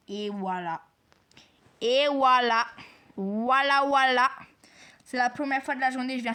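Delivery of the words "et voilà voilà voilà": loud, exultant cheer; triumphant shout. Real, not acted. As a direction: belt it out in triumph. Triumphant repeat after lucky find. (cri de triomphe)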